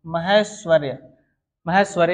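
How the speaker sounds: ordinary talk, nothing marked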